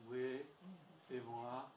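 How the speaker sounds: unwelcoming phone answer. Shown as a demonstration. Answering a caller you don't want to hear, reluctant. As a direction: sound like you'd rather not talk. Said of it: "Si vous faites".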